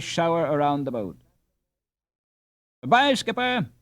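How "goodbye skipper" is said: cheerful farewell shout. A narrator's reading. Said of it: "shouted the boys".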